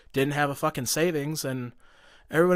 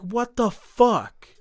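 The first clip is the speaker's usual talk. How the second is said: slow, high, incredulous outburst; exasperated retelling, pitched well up; retold outraged disbelief; 7 semitones higher, 4 dB louder, much slower than usual. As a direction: stretch each word in acted-out bewilderment. Acts out shock at doctor walking out.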